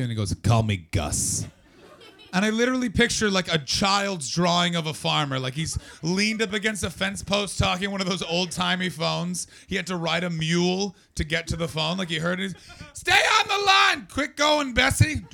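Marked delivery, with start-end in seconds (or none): 0.4-1.5 low, slow, deliberate farmer; slow farmer drawl. Retold or imitated. Farmer introducing himself. 12.9-15.2 much higher, loud hammed-up yell; yelling farmer. Imagined farmer yelling at mule.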